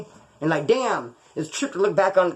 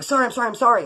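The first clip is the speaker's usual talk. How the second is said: quick, higher pleading sorries; panicked apology; 7 semitones higher, faster than usual. Retold or imitated. After flipping his scooter, apologizing to the driver in a panic. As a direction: apologize in a rush like you crashed. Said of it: "I jumped up all panicked".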